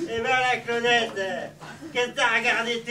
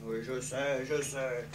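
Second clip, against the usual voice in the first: much lower, softer mutter; 13 semitones lower, 12 dB quieter than usual. Acted. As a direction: grumble it under your breath. Weary muttered aside. (marmonner tout bas)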